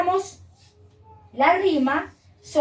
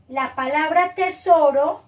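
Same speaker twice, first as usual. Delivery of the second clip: tesoro drawn out slowly and loud; "tesoro" said slowly, with weight; stress on tesoro; 3 semitones higher, 5 dB louder than usual. Shown as a demonstration. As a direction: stretch out "tesoro" to show its ending. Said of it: "al final tienen las mismas terminaciones".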